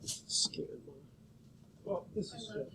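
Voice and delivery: whispered to herself under the breath; barely voiced, just for herself; a soft aside whisper while the machine runs. Real, not acted. Murmuring to self while scanning.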